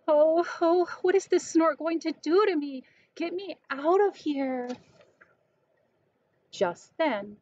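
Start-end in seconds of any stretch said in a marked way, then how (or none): 0.1-4.7 high, urgent little-bird cry for help; scared baby bird. Acted. Baby bird carried off by the snort, high mock fright. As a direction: plead to get down.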